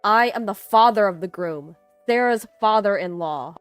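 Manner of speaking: loud, commanding announcement; father-in-law's forceful reply; stern declaration. A narrator's reading. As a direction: declare who you are with force. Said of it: "The C E O voiced his anger".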